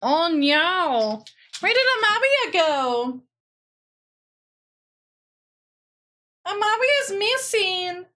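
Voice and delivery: high, slow mock alarm; mock panic. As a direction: pretend to panic, squeaky and slow. Mock panic over missing friend.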